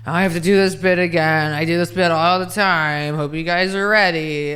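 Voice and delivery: falling apart on stage. Retold or imitated. Comic groaning to the crowd about repeating a bit. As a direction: moan at the crowd, tired of the bit. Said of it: "just be like".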